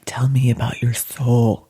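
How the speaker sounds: whispered, mock-deep question; hushed like a spiritual seeker; mock soulful whisper. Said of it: "It's not like".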